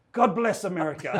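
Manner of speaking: imitated thick Eastern European accent. Smoker celebrating her broken resolution, imitated Eastern European accent. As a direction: deliver the punchline in a mock Eastern European accent. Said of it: "thick Eastern European accent".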